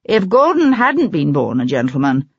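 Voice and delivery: usual speaking voice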